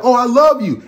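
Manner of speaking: high, loud gushing; mock lovesick guy. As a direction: swoon it out. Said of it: "So now you're like".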